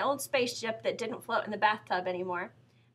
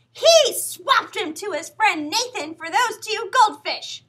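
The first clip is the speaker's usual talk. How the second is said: put-on much higher, louder, slow sing-song tattle in a little girl's voice; tattling little sister; 10 semitones higher, 10 dB louder, slower than usual. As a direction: tell on your brother in a high, drawn-out child's voice.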